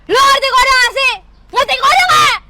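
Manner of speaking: shouted, fed-up demand; angry outburst. Acted. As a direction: yell it in exasperation. Acted quarrel, loud outburst.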